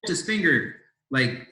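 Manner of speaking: ordinary talk, nothing marked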